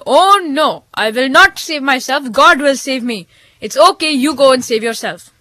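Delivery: lightly voiced holy man insisting; stubborn refusal. A narrator's reading. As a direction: Manda Baba declines the car. Manda Baba refuses again.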